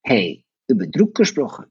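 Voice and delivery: usual speaking voice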